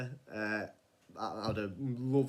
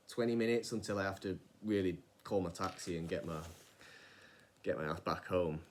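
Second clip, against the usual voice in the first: ordinary talk, nothing marked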